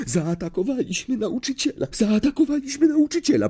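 high-pitched panicky repetition, speeding up; horrified. A narrator's reading. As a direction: repeat it in dawning horror, high. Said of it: "patrząc przerażonym wzrokiem". (przerażony, wysoki głos)

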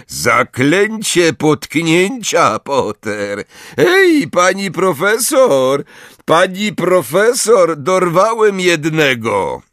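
high, triumphant holler; gloating calling-out. A narrator's reading. Malfoy calls professor after catching one, gloating.

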